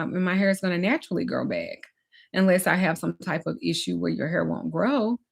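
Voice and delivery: normal talking voice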